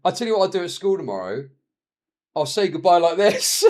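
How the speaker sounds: can barely get the son's line out for laughing; laughing while quoting. Retold or imitated. To viewers, retelling his son's line while laughing.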